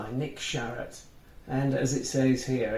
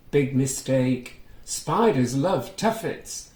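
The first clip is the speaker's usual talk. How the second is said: lively, sing-song storybook reading, a little higher and louder; reading to children; 4 semitones higher, 3 dB louder than usual. A narrator's reading. Reading a picture book aloud to young children, lively storytelling. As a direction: bring the story alive for little listeners. Reads Mother Goose's reply.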